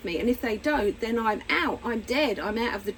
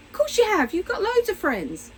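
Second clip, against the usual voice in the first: brushing it off; 8 semitones higher than usual. Retold or imitated. Mother brushing off daughter.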